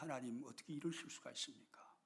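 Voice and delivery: despairing whisper to God; whispered complaint. Acted. Villagers reproaching God after the fire, in a whisper. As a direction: breathe the reproach out, barely voiced. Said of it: "하나님을 원망합니다".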